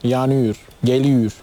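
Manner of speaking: "yanıyor, geliyor" with the final o turned into u; Adana pronunciation. Shown as a demonstration. Guest explaining his hometown dialect, "yanıyor, geliyor" in Adana pronunciation. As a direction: say "yanıyor, geliyor" with a u-like last vowel, Adana style. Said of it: "Yani oradaki o, u oluyor".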